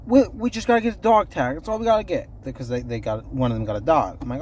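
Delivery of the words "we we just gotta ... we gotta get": lightly mimicking a breezy coworker, higher pitched; imitating coworkers. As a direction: toss it off like a carefree shopper. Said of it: "They literally said".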